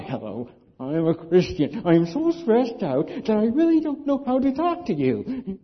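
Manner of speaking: loud, high, frantic mock greeting; a hammed-up panicky believer blurting at a stranger. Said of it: "Nobody likes to be talked to".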